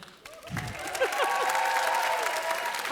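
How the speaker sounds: airy giggle after the greeting; breathy high laugh. Real, not acted.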